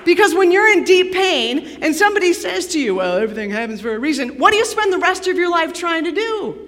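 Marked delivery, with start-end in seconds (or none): 3.0-4.4 quick, lower-pitched throwaway quote; glib platitude. Retold or imitated. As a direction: rattle it off as pat advice. Quoting a glib comforter's platitude.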